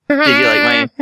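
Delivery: talking through a noisemaker; party blower in mouth. Real, not acted. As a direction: speak around a party blower. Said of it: "that's my impression of a noisemaker".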